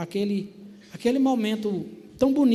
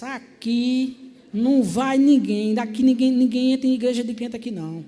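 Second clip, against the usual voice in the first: firm loud refusal; 5 semitones higher, 7 dB louder than usual. Retold or imitated. Mother-in-law refusing church, loud and stubborn.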